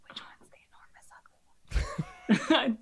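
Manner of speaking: slow, voiceless whisper; whispered aside. Acted. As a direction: whisper it secretly to a companion. Secret aside to companion while kneeling.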